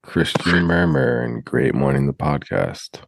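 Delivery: booming, low announcer voice opening a show; grand announcer intro. Pretend. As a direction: hype the show like a big-stage host. Mock podcast intro announcement.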